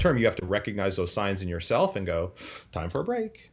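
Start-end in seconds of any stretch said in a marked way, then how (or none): 2.7-3.5 high, light self-reminder; sing-song self-talk. Retold or imitated. Trainer telling himself to step away, high and light.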